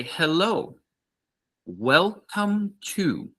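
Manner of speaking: high, slow, clear shop greeting; slow model greeting. Shown as a demonstration. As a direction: say it slowly for learners to copy. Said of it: "we would say".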